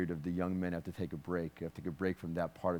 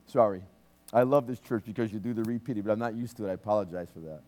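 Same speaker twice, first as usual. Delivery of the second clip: ordinary delivery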